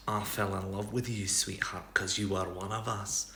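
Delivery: put-on soft Scottish accent, intimate; Scottish Rhysand. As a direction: murmur the confession in a Scottish accent. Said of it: "Rhysand and Helion speaking definitely in a Scottish accent".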